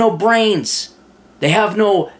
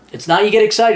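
normal talking voice

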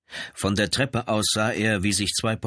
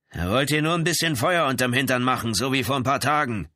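high, forceful character voice; threatening angry brother; 7 semitones higher, 4 dB louder than usual. Acted. Brother explains the gun to police, heated. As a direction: answer as the agitated brother, pitched up.

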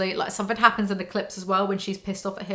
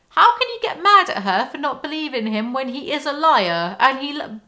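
louder, higher outraged rant; exasperated rant; 6 semitones higher, 5 dB louder than usual. Real, not acted. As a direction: blurt it out in disbelief. Ranting at Bella's critics.

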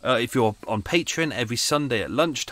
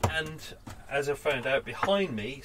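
ordinary talk, nothing marked

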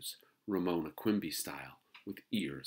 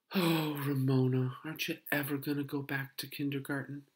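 high, sighing plea; dismayed mother; 9 semitones higher, 5 dB louder than usual. A narrator's reading. Mother asks daughter about kindergarten, dismayed.

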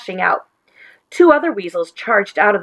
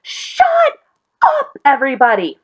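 huge yell leaping very high on the first word; the leader yelling over the noisy weasels at the table; loudest yell; 6 semitones higher than usual. A narrator's reading. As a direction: roar it to silence the whole room. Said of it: "loudest yell Wharton had ever heard".

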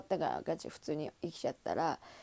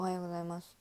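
flat, mumbled good-morning with no smile; curt greeting; 3 semitones lower, 7 dB quieter, faster than usual. Retold or imitated. 眠い高校生が挨拶を無愛想に. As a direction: grunt it out like you don't care. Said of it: "無愛想".

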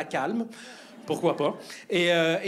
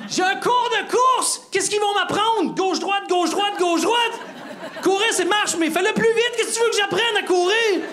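hammed-up furious rant, very high and loud; mock apoplectic tirade at full pitch; enraged brother-in-law; 13 semitones higher, 3 dB louder than usual. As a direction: yell it in comic outrage, barely stopping. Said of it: "pas de raison de s'énerver".

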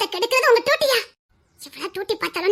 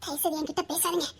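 much softer and lower, withdrawn; cold quiet plea; 8 semitones lower, 10 dB quieter than usual. Acted. Wife pushing her husband away, low and quiet. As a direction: say it almost under your breath, shutting him out.

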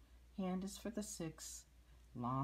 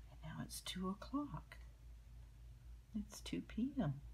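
whispering; 4 semitones higher, 5 dB quieter than usual. Real, not acted. Whispering the time to child.

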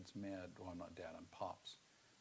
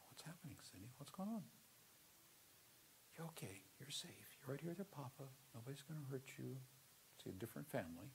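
softer, higher, gentle reassuring voice; talking softly; 5 semitones higher, 7 dB quieter than usual. Retold or imitated. Papa comforts his panicked adopted daughter, soft and reassuring. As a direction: soothe a trembling child, softly. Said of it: "began talking softly".

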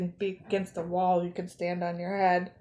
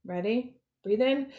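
plain reading voice

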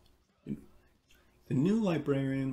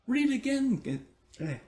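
put-on high, louder pleading chant of kids; children begging; 12 semitones higher, 3 dB louder than usual. A narrator's reading. Children beg the librarian to read again, high excited cry. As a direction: beg for more like thrilled children. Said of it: "they cried".

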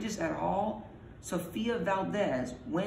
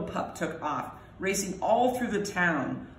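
usual speaking voice

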